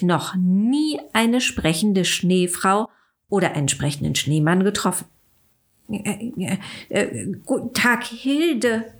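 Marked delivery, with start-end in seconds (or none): 5.9-8.9 hesitant, shy stutter; stammering shyly. A narrator's reading. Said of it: "stotterte Willi ein bisschen verlegen".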